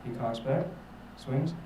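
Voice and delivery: low, soft play-by-play in a hush; golf commentator. Acted. Commentator as Tiger Woods nears his final hole, hushed low voice. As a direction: narrate the crucial putt low and quietly. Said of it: "and then I hear it again".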